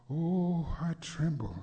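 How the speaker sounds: chanting sermon with swelling peaks; preached poem. Acted. Rhythmic preached poem throughout.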